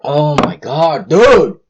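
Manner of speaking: slow, high, then a loud yelp on dude; ecstatic outburst. Real, not acted. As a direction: let the delight burst out of you. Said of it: "I hope you have a change of pants".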